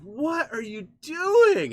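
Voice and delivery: high, loud, slow drawn-out disbelief; incredulous quote. Retold or imitated. Streamer imitates a friend baffled by the quote, high and drawn out. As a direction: act out his baffled reaction. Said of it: "and he was like".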